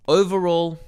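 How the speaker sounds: bursts out loud and high on the wrap-up word; a big announcing call to close the review; loud sign-off. Real, not acted. Reviewer announcing his final verdict to viewers, loud and high.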